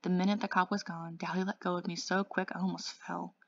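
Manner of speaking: usual speaking voice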